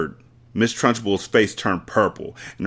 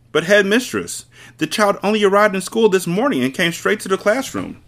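a hint of a young teacher, higher and pleading; timid teacher; 11 semitones higher than usual. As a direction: Miss Honey protesting politely to the headmistress, higher and pleading. Miss Honey pleads with headmistress.

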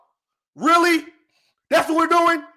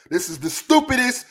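ordinary delivery

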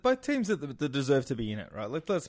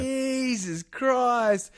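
drawn-out exasperation; 6 semitones higher, much slower than usual. Real, not acted. Exasperated reaction to hire.

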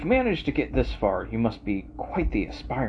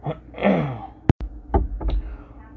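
throat clear; 4 semitones higher than usual. Real, not acted. Clears throat before reading.

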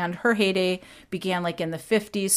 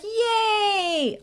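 a much higher cheer of joy; excited kids; 14 semitones higher than usual. Retold or imitated. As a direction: cheer like thrilled children. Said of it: "I'm gonna be doing some impressions".

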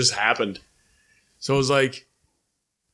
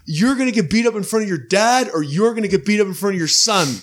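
high, heated mock threat; retold threat; 6 semitones higher than usual. Threatening the dad and son from his car, heated mock threat. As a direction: lay it out high and cocky.

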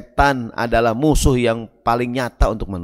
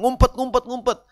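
urgent hide call; 9 semitones higher than usual. Acted. Ant king urging ants to hide.

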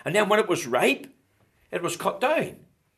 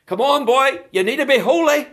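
loud, high, mock pep talk; hectoring exhortation; 9 semitones higher, 9 dB louder than usual. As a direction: urge them forcefully, voice raised. Acting out a hectoring adviser.

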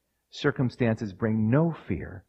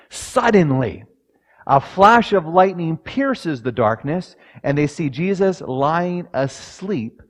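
loud, raised sermon reading; preaching reading; 6 semitones higher, 7 dB louder than usual. Acted. Preacher reading the storm passage to congregation, forceful. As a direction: proclaim the passage to the church.

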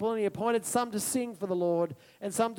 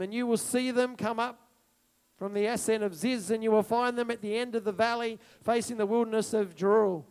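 normal talking voice

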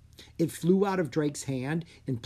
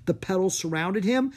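ordinary delivery

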